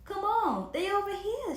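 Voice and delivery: loud, high calling out; high shout across the lot. A narrator's reading. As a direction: call the boys over loudly. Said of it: "shouted Mel".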